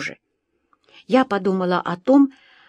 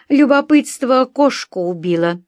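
higher, louder, curt retort; dry retort; 5 semitones higher, 5 dB louder than usual. A narrator's reading. Husband answers Sanchez's question, dry and emphatic. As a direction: put the guest off with a dry, firm answer. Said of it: "сухо ответил мой муж".

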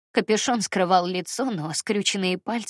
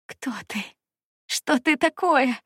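hoarse; 5 semitones higher than usual. A narrator's reading. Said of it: "прохрипела я".

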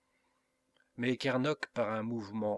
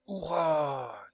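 loud, high, drawn-out hurrah; crew's cheer; 5 semitones higher, 6 dB louder than usual. A narrator's reading. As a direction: cheer as for a whole crew. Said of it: "cria l'équipage tout d'une voix". (hourra crié par l'équipage)

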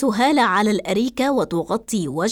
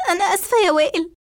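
high, fast, crying apology; acted sobbing plea, rushed and high; tearful pleading; 8 semitones higher, faster than usual. A narrator's reading. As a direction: beg forgiveness through tears. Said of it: "فتبكي إسراء وتنظر له باستعطاف".

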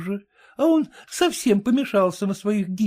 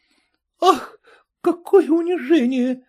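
в отчаянии; 8 semitones higher, 3 dB louder than usual. A narrator's reading. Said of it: "ломала руки барыня".

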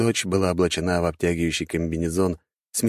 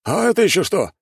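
high, loud startled question; alarmed cry; 13 semitones higher, 5 dB louder than usual. A narrator's reading. Soldier spotting something huge from the sea, alarmed shout. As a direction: shout out in disbelief. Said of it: "раздался крик".

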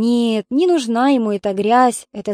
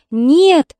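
a louder narrated cry of no; shout; 4 semitones higher, 5 dB louder than usual. A narrator's reading. Тётя Рая refuses the amputation, narrated louder cry. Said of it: "закричала она".

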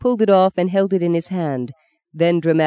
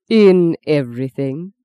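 In everything? with everything weighted, slow and louder; emphasised word; 5 dB louder, slower than usual. Acted. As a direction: lean on everything with a sly challenge. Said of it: "you do know how to emphasize words".

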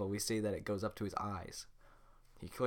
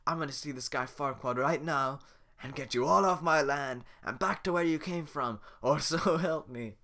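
Shrek voice; 5 semitones higher, 6 dB louder than usual. Acted. Shrek threatening the crowd.

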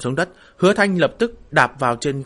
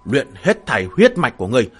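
frenzied furious shout; burning scream; 2 semitones higher, 5 dB louder than usual. Acted. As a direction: roar it out in mad rage. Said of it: "cả người trở nên điên cuồng".

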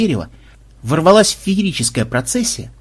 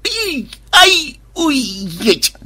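high-pitched yelps, one per bump; yelps of pain; 11 semitones higher, much slower than usual. A narrator's reading. Programmer crashing through tree branches, yelps of pain. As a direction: cry out at each knock on the way down.

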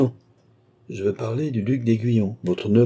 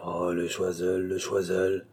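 low, softened murmur to oneself; 4 semitones lower, 6 dB quieter, slower than usual. A narrator's reading. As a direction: murmur the name, lower and softer. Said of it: "murmura madame du Barry". (murmuré, tout bas et plus grave)

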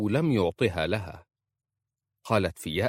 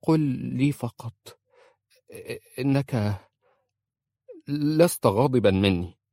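halting, higher pleading words; stammering plea; 5 semitones higher, 3 dB louder than usual. A narrator's reading. Wife begs husband in the dark, stammering. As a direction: she stumbles over her plea to him. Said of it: "قالت في تلعثم".

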